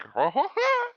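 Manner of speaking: shrill high clown cackle; silly clown laugh. Retold or imitated. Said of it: "He's like".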